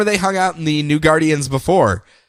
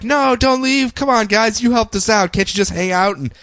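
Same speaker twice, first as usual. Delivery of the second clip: much higher, begging mock plea; pleading crowd; 7 semitones higher than usual. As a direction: beg them not to go. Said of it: "people of Earth are like".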